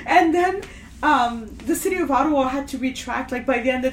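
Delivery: usual speaking voice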